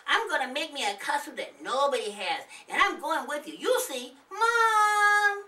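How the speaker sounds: put-on high, loud pleading kid voice; a small boy begging his mom, voice up high; eager little boy.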